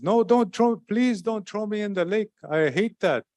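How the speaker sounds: high, hammed-up pleading trickster voice; mock begging. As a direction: beg them, pretending to dread the lake. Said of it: "and he says".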